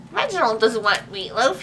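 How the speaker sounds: plain reading voice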